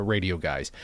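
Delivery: usual speaking voice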